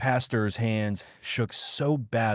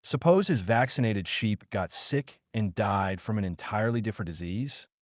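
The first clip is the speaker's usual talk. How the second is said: ordinary delivery, nothing marked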